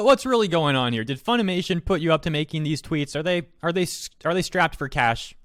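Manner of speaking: ordinary talk, nothing marked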